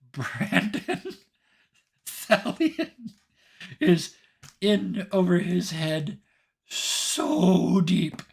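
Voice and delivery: high, loud voice breaking into laughter; laughing amusement. Real, not acted. Game master teasing player about being in over his head, laughing. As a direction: push each phrase out through real laughter.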